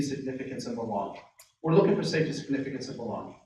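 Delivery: ordinary talk, nothing marked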